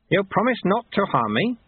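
high, checking question; seeking a firm promise. Acted. Wary sweeper checks the promise.

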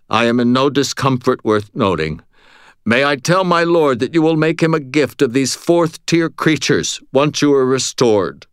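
smooth and deferential; courteous servant voice. Acted.

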